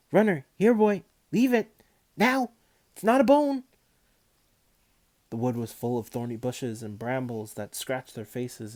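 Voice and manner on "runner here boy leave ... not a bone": much higher, much louder calls to the dog; calling the dog. A narrator's reading. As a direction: shout the dog away from the hole.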